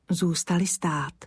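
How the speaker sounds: ordinary delivery, nothing marked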